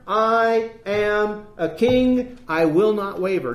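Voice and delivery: very slow, drawn out declaration; solemn slow proclamation; emphatic preaching. Acted. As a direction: stretch each word like a vow. Affirming Jesus' words emphatically.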